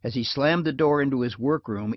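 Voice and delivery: plain reading voice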